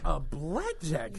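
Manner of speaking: drawn-out incredulous question; slow disbelief. Retold or imitated. Driver repeats the weapon, slow and disbelieving. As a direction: stretch it out in disbelief.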